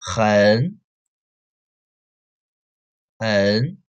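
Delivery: 很 said with a rising second tone; second tone hen. Shown as a demonstration. Teacher drilling the rising 很 for the class, rising. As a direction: lift 很 up. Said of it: "change the first one into the second tone".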